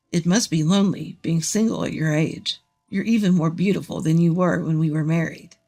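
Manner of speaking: low voice of a presumptuous man; smug ex-husband. Acted.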